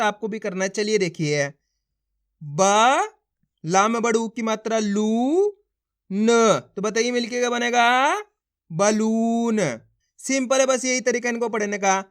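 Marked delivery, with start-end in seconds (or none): 2.4-6.3 बा, लू, न sounded separately, vowels held; letter by letter. Shown as a demonstration. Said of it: "एक ही अक्षर का उच्चारण कर रहा हूँ". 8.7-9.6 बलून as one drawn-out word; syllables joined. Shown as a demonstration. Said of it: "उनका उच्चारण करो".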